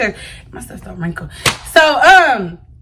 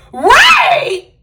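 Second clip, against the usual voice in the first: put-on [name] voice; 13 semitones higher than usual. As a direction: squeal it like [name] in disbelief. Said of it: "In my [name] voice".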